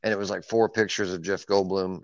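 ordinary talk, nothing marked

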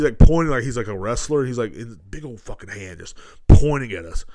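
ordinary talk

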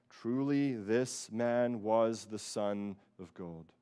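slow, weighty reading of the confession; slow solemn quote. Retold or imitated. Quoting the centurion's confession slowly and solemnly. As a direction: let each word land slowly. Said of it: "he said".

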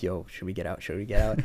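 whisper-ish worry to each other; nervous hush. Retold or imitated. Friends quietly asking whether to bail from the car. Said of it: "they were just like".

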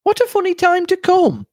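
very high-pitched surprised exclamation; exclaiming. A narrator's reading. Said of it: "exclaimed Cynthia".

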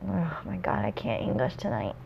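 ordinary delivery

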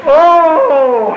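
high, drawn-out Oh; fervent preacher's exclamation; exultant praise. Real, not acted. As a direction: let a long Oh ring out in praise.

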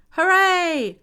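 high, loud hooray; stretched, excited group cheer; joyful cry. A narrator's reading. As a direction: cheer it out like delighted children. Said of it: "cried the children".

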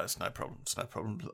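soft, low, half-voiced reassurance; quiet brush-off. Retelling himself waving off a girl's thanks, quiet brush-off. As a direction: let it drop away, barely voiced.

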